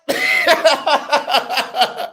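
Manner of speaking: falling high laugh trailing off; high laughter. Real, not acted. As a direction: let the laugh fade out. Laughing at lawyers' argument.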